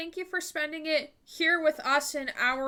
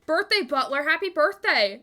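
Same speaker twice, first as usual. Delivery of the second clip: excited; 5 semitones higher, 7 dB louder than usual. Real, not acted. Greeting birthday chatter.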